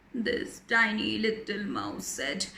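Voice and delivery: plain reading voice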